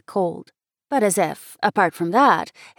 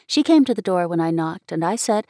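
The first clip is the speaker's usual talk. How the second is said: ordinary delivery, nothing marked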